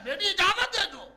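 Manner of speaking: loud, high-pitched urging; urgent plea. Acted. Dying father asks daughter to admit the angel, loud urgent plea. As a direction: cry out the request.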